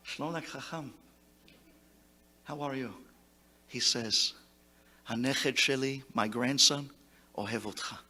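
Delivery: soft, low, intimate; hushed tender exchange. Retold or imitated. Sage greeting the teacher, hushed and tender. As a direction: speak it softly, like a memory.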